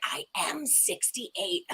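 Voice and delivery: slow, soft, grudging admission; conceding mother. Retold or imitated. Mother calling back to admit her real age, slow and soft. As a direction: drag it out, quietly giving in. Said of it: "She's like".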